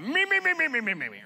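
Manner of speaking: loud, high-pitched monster chant, dropping at the end. Acted. Preacher acting out the monster that takes over, high and loud. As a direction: pipe up high as a greedy me monster. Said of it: "the me monster comes out".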